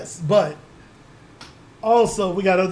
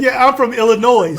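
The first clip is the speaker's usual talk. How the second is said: laughing mockery; 4 semitones higher, 9 dB louder than usual. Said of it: "That's like pronouncing the S in Illinois".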